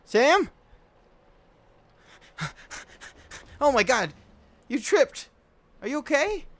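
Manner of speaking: high, loud panicked calls; alarmed panic. Acted. Finding Sam bleeding, high panicked cries. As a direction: panic over a hurt friend. Friend finds Sam hurt.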